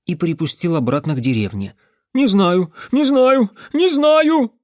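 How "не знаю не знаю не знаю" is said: loud, high cries climbing each time; shouting. Acted. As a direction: scream it higher with every repeat. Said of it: "Его крик разнёсся по всей округе". (громкий высокий крик)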